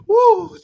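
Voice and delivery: very high, loud joyful woo; excited whoop. Real, not acted. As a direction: let out a happy yell. Excited outburst before handing over.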